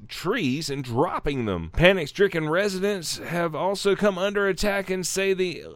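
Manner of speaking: deliberate article read-out in an announcer style; news read. Acted. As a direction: read the report out with dramatic emphasis. Reads article aloud.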